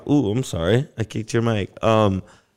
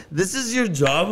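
high, slow sarcastic jab; scornful question; 10 semitones higher than usual. Retold or imitated. Mocking the cop's job while retelling the stop, high and slow. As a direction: sneer at his job.

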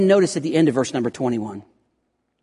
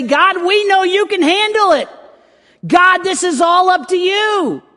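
high, loud cry of trust to God; bold prayer; 6 dB louder than usual. Acted. Preacher voicing the congregation's prayer to God, loud and high. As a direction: proclaim it up to God with full confidence.

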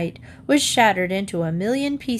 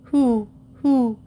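lightly voiced owl hooting, a touch louder; 4 semitones higher, 4 dB louder, slower than usual. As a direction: hoot it like a drowsy owl. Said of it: "Pulitzer said".

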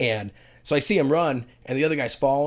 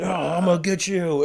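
mock menace, pitched up from usual; in-character wrestler threat; 6 semitones higher than usual. Slapped wrestler to his opponent, staged threat through the pain. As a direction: play a hurt wrestler keeping up the act. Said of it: "he's trying to stay in character".

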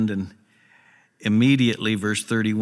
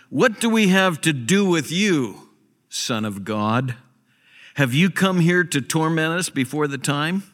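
raised, pitched-up cry of the demons; 6 semitones higher, 3 dB louder than usual. Retold or imitated. Preacher voicing demons crying out to Jesus, raised cry. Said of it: "they cried out".

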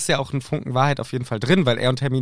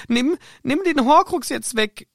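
voicing Hermine; 9 semitones higher than usual. Voicing Hermine reading aloud.

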